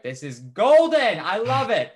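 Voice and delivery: loud, high, drawn-out relish; gleeful delight. Retold or imitated. Onlooker watching rude customers get ignored, gleeful delight. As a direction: crow with delight at the spectacle.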